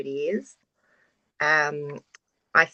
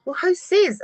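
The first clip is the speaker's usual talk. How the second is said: high, defiant little challenge; mock-defiant higher retort; defiant inner voice; 12 semitones higher, 4 dB louder than usual. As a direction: toss it back like who made that rule. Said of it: "And then I'm like".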